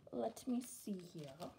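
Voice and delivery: soft whispered aside; whispering. Real, not acted. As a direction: whisper it to yourself, slowly. Aside while sorting bags.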